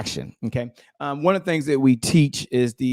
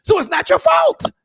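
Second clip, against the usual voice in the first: real hyped-up shout; emphatic shout. Real, not acted. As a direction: bellow it to convince them. Coach reassuring audience.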